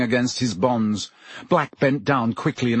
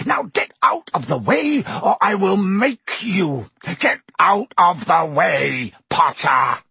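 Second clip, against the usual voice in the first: raging Snape; 6 semitones higher than usual. A narrator's reading. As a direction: menace him into moving.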